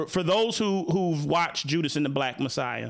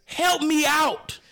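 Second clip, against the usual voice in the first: exasperated plea; 8 semitones higher than usual. Real, not acted. Host pleading with director Ryan Coogler, high and exasperated. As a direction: throw up your hands at the director.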